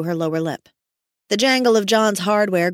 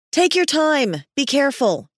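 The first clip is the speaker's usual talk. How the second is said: high, raised warning call; urgent call at a raised pitch; yelling; 7 semitones higher than usual. A narrator's reading. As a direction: yell a caution down to a climbing partner. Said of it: "she yelled".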